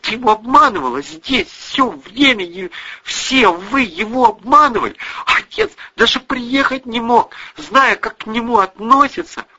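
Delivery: high-pitched, heated accusation; accusing. A narrator's reading. Daughter accuses mother of betraying father, heated.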